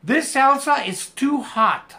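this salsa is too hot drawn out slowly; slow model sentence. Shown as a demonstration. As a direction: say it slowly so students copy. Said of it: "Ok, so". (lectura lenta para los alumnos)

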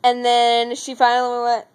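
ordinary talk, nothing marked

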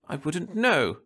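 a wife stiffly brushing off the question. A narrator's reading. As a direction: answer coldly, clipped short. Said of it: "she said stiffly".

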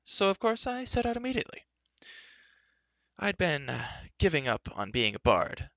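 high, swooping theatrical bard; grandly casual storyteller bard; chatty bard, self-important. A narrator's reading. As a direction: explain airily, with flourishes of pitch.

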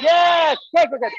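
loud, high cheering; excited praise. Real, not acted. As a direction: hype the crowd up. Cheering the audience on.

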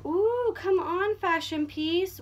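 drawn-out gleeful "ooh"; delighted. Real, not acted. Excited by a new garment.

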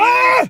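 high shouted cry; sudden yell. Acted. Outburst in the struggle in the car, yelling. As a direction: burst out with one high-pitched yell. Outburst during the phone call.

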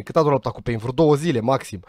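ordinary talk

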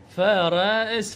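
فرس stretched very slowly, letting the final س hiss; very slow teaching drawl; drawn out slowly. Shown as a demonstration. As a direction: draw the word out for the class. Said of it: "بالأخير إس".

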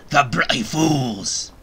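hammed-up menace; slow mock-menacing pirate snarl; mock threatening pirate. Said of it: "I be very threatening".

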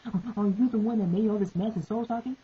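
high, loud mock challenge; hammed-up hero. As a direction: play the hot-headed hero calling out. Acting out the fight scene.

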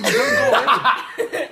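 high, slow laughing whine; laugh-whine through the burn. Real, not acted. Pepper heat, laughing whine.

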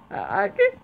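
squeaky high breathless laugh, can't get words out; laughing so hard the voice cracks up high; helpless laughter. Real, not acted. Gamer to viewers, crying with laughter.

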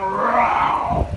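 a drawn-out lion's roar. Acted. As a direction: roar it out like a lion. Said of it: "come like a roaring lion".

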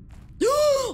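loud, high startled gasp; sharp gasp. Real, not acted. Streamer scared by a monster in the game, sharp gasp. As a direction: suck in air at the jump scare.

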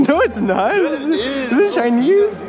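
laughing. Real, not acted. Laughing protest.

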